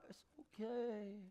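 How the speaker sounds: high, very soft soothing. Acted. Father to his little girl, soft and soothing. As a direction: gently coo it, imitating a doting dad. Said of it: "I admit I'm a little softer".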